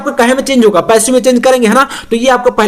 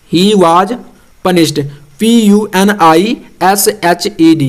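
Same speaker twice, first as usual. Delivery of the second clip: slow spelling out; 3 semitones lower, much slower than usual. Shown as a demonstration. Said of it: "spelling letters aloud".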